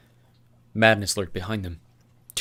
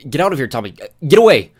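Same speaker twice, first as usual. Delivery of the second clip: louder, higher get-away bark; sharp warning; 6 semitones higher, 14 dB louder than usual. Acted. Newt warning Thomas off, loud and sharp. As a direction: drive him away.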